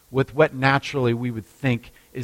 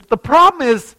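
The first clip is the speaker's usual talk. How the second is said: much higher and louder explanation; higher, louder excuse; raised voice; 11 semitones higher, 8 dB louder than usual. Retold or imitated. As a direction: call it out so he can hear. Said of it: "And she says".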